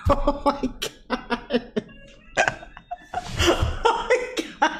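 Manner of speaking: words lost in breathy laughter; real helpless laughter. Real, not acted. Storyteller cracking up over almost killing little brother, helpless laughing. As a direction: barely get it out while cracking up.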